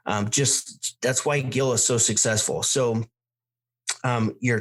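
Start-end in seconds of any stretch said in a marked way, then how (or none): none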